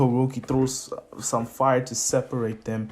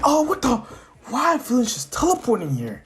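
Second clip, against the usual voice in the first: high-pitched mock bewilderment; acted startled reaction; 9 semitones higher than usual. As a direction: play a crowd caught off guard. Police react to villain appearing.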